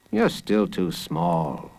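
low, slow dad voice; deep, unhurried parental refusal; father. A narrator's reading. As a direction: turn the child down slowly and low. Father turns boy down.

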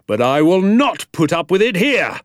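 high, rising, emphatic shout; irate schoolmaster laying down the law; angry outburst. Acted. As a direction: build to a furious refusal. Teacher lectures class angrily.

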